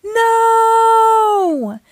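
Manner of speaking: long, high, loud held wail; slow-motion cry. A narrator's reading. Dad too late to stop Naughty Ninja, slow-motion cry. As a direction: stretch it out like a film in slow motion. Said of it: "Dad cried in slow motion".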